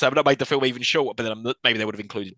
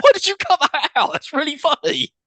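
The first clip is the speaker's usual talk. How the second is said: laughing outrage; 6 dB louder than usual. Real, not acted. Laughing outrage at cut scene.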